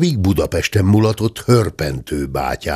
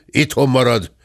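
ordinary delivery

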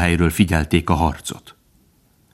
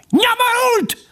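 extremely high, piping squeal; shrieking weasel; slower than usual. A narrator's reading. Weasel insulting the dog, shrill piping cry. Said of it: "sipította menyét".

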